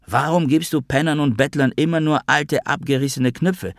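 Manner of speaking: high-pitched angry accusation; angry and loud. A narrator's reading. Noah accuses his father about beggars, cross and high-pitched. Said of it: "sagte Noah böse und laut".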